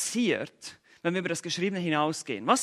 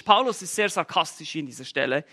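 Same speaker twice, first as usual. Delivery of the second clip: usual speaking voice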